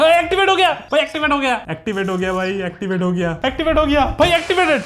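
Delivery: real burst of delight at the robot; excited shout. Real, not acted. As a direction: cheer as it finally switches on. Robot finally activates.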